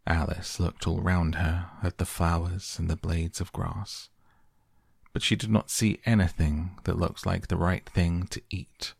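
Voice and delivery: ordinary delivery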